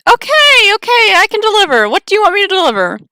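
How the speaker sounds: panicked high clerk; scared clerk. Acted. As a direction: squeak in alarm.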